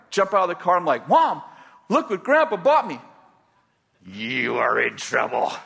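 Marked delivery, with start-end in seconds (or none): 1.1-3.0 put-on excited kid. Excited kid showing hammer. 4.0-5.5 lower, slow stern mom; mother scolding her son. Retold or imitated. Angry mother scolds child.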